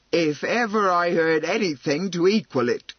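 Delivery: loud, aghast exclamation; gasped. Acted. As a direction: gasp it out in outrage. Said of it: "gasped Missus Flor".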